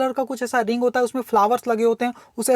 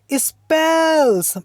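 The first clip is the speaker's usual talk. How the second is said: poem reading; 6 semitones higher, 3 dB louder, much slower than usual. Shown as a demonstration. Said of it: "reads the magician's answer".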